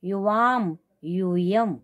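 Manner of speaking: long, stretched vowels on each word; drawn-out model pronunciation; slow recitation drill. Shown as a demonstration. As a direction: recite slowly for students to copy. Models forms after correcting pronunciation.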